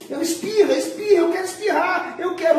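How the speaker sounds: high-pitched, hammed-up demanding voice; insistent brain. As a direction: insist frantically, as a mock brain wanting a sneeze. Acts out brain insisting on sneezing.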